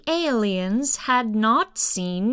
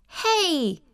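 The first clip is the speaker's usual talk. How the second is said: put-on high, sharp call of a big kid; big kid shouting; 8 semitones higher than usual. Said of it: "he shouted".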